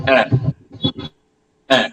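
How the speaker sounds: "Uh" said like the A in apple; E with accent grave. Shown as a demonstration. Teacher repeats the downward accent's vowel, E grave sound. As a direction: open "Uh" as apple begins. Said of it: "A in the English word apple".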